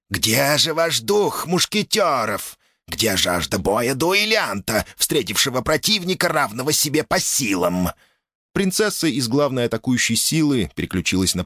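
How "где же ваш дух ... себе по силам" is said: slow, sinister demon taunt. Acted. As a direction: gloat like a horror host. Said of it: "зловеще захохотал". (зловещая насмешка)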